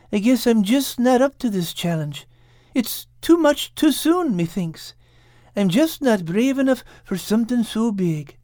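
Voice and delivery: high-pitched character voice, sorry farewell; regretful Al. Acted. Al sadly says goodbye.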